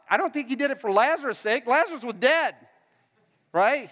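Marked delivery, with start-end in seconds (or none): none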